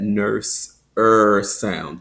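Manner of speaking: "nurse" and "er" with a rounded American R; rhotic er. Shown as a demonstration. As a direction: hold a full R in "er". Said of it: "the rounded R".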